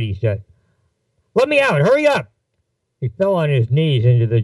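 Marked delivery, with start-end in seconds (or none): 1.3-2.3 very high, strained urgent demand; frantic plea. Acted. Passenger about to vomit begs to get out, frantic high plea. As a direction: shoot the pitch right up, desperate to get out.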